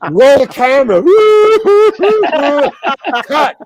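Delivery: loud, high, laughing through the orders; laughing director. Retold or imitated. Director rolls camera on the actor, laughing loudly. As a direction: call the shot while cracking up.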